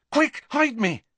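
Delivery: hurry, hide me now, alarmed; panicked hiding. A narrator's reading. Ron hides from girls.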